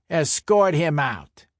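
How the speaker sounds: forceful mob-boss dismissal; barked order. Acted. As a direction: order your men to throw him out. Enforcer orders his men.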